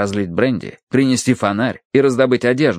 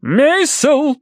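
very high, sharp matronly bark; barked name; 4 dB louder than usual. A narrator's reading. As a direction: bark at your husband from the couch. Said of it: "рявкнула миссис Меринг с кушетки". (рявкнуть высоким голосом)

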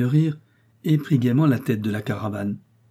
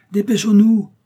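loud, high hurry-up call; father shouting to hurry everyone along; 10 semitones higher, 9 dB louder than usual. A narrator's reading. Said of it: "cria monsieur Richard".

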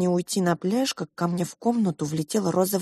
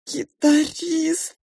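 put-on high, drawn-out crying child; tearful wail; 10 semitones higher, much slower than usual. A narrator's reading. Child blaming guitarist to pink mouse, crying. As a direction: wail the word slowly through tears. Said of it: "снова громко заплакала я".

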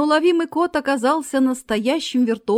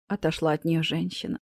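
ordinary delivery, nothing marked

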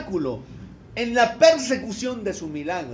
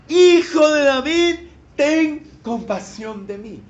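loud, higher, slow cry of Hijo de David; crying out louder; 5 semitones higher, 7 dB louder, slower than usual. Retold or imitated. Blind man crying out above the hushing crowd, loud and slow. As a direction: plead harder, stretching out each word. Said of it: "él clamaba mucho más".

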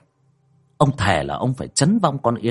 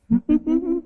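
high, spiteful cackle; malicious ghost laugh. Acted. Ghost looming over her victim, venomous laugh. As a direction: cackle spitefully before the threat. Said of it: "cay độc rít lên".

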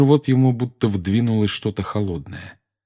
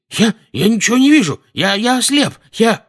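panicked; 6 dB louder than usual. Acted. Dudley blinded and panicking.

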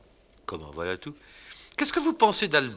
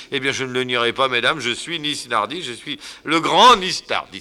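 louder, building to a grand boast; pompous singer; 3 semitones higher, 8 dB louder than usual. Acted. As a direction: announce yourself like a great star. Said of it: "jouer un rôle".

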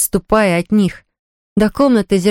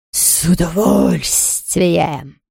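slow, drawn-out ghostly relish; howling ghost; 2 semitones lower, much slower than usual. A narrator's reading. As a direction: stretch it out like an eager ghost. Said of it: "взвыл Хуга". (протяжное завывание привидения)

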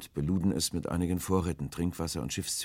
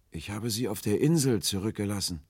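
raised, distant-sounding reply; forlorn answer; 6 semitones higher, 3 dB louder than usual. A narrator's reading. Stranger answers Will from the boat, high and forlorn. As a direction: answer mournfully, pitched up.

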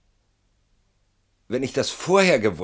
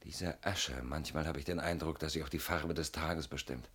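very soft, sunken murmur; weary hush; 5 semitones lower, 15 dB quieter than usual. Acted. Confessing his exhaustion to the old friend, very softly. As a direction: let it sink almost to nothing.

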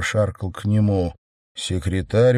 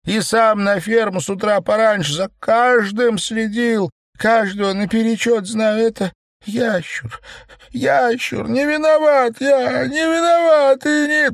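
rising into a much higher tearful wail; crying falsetto; 12 semitones higher than usual. A narrator's reading. Chairman pleads his innocence, voice breaking into falsetto. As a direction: plead as the voice cracks upward. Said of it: "Его голос задрожал, срываясь в плачущий фальцет".

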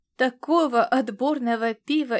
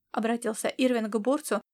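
ordinary delivery

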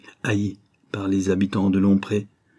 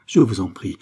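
loud plea at usual pitch, falling; 5 dB louder than usual. Acted. Mother begging the men for mercy, falling plea. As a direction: plead firmly, letting the tune drop.

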